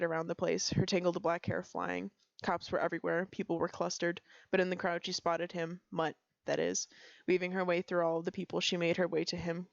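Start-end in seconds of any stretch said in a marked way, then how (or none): none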